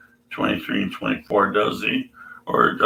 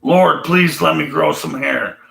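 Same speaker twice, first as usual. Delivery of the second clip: 1 louder mock plea; 6 semitones higher, 5 dB louder than usual. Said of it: "I would say".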